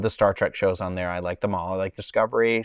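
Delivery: usual speaking voice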